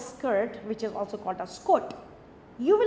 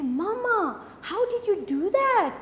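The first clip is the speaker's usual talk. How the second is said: high, wide-eyed kitten voice, amazed; amazed little kitten; 8 semitones higher, slower than usual. Acted. Kitten asks its mother, full of wonder. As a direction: squeak it out like a kitten in awe.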